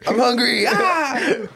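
a joking possessed moan; mock possessed ghoul. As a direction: ham up a starving demon. Mocking the possessed hungry lady.

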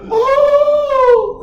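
long, high, loud mock "Oh!"; offended gasp-cry. Girl reacting to being called out, exaggerated offended cry. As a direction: imitate a scandalized squeal, held out. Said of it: "she gets so offended".

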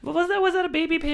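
very high, incredulous squeal; high-pitched, disbelieving challenge; incredulous question. Real, not acted. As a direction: shoot the voice way up in disbelief. Challenged about a rival baby pig.

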